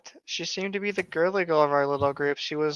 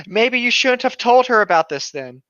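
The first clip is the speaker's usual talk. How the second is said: loud, higher jab; loud snark; 7 semitones higher, 8 dB louder than usual. Acted. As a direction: jab at her loudly and higher. Reading a snarky line.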